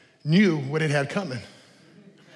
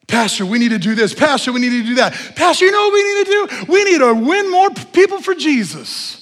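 higher, faster nagging church member; pushy churchgoer; 8 semitones higher, 7 dB louder than usual. Retold or imitated. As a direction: pester the pastor with demands. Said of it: "All they are is a bunch of symptoms".

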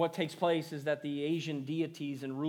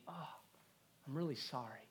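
soft, hushed sympathy with a pause; compassionate; 10 dB quieter than usual. Acted. Compassionate person pausing to comfort a sufferer, softly sincere. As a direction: take a moment, then console quietly. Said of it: "somebody with compassion that's going to take a moment".

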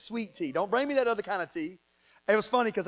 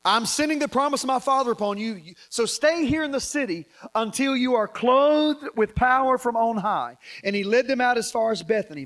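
loud, higher, declaimed Bible reading; scripture reading; 4 semitones higher, 7 dB louder than usual. Real, not acted. Preacher reading Jesus's farewell and ascension, forceful scripture reading. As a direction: proclaim the verse at full voice.

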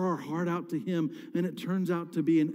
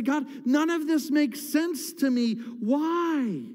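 higher, exasperated protest, the last word drawn out; shaking a fist at God; 6 semitones higher, slower than usual. Acted. Believer complaining to God, crying out in protest. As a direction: cry it out in mock frustration. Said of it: "shake our fist at God".

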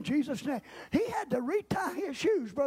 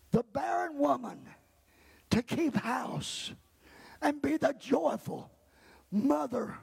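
usual speaking voice

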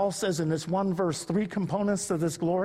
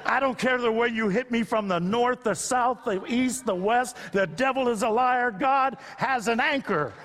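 defiant proclamation; 6 semitones higher than usual. Acted. Preacher proclaiming defiant faith.

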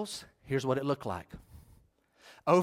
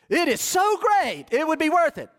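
emphatic climax; 10 semitones higher, 4 dB louder than usual. Shown as a demonstration. Preacher insisting it is so great, high and emphatic. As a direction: push each word up high and hard.

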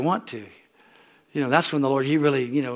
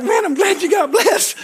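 mock shout; 14 semitones higher, 5 dB louder than usual. Mocking reply to flowery prayer.

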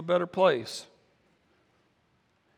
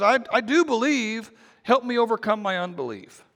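high, pleading cry for help; a frightened father begging; desperate plea; 6 semitones higher, 3 dB louder than usual. Retold or imitated. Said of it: "the father's horrified".

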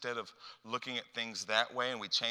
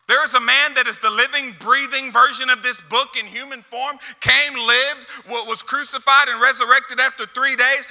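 loud, high, full-force proclamation; fervent sustained pulpit shout; impassioned preaching; 13 semitones higher, 17 dB louder than usual. Real, not acted. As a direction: proclaim the good news at the top of your voice.

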